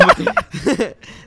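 high, loud laugh; high laugh before the punchline; burst of laughter. Real, not acted. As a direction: break into laughter. Laughing mid-story.

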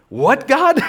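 high, loud shocked disbelief; incredulous what. Retold or imitated. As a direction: blurt it out in total disbelief. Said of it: "you're gonna be like".

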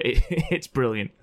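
ordinary delivery

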